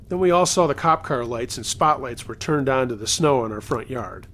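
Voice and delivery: usual speaking voice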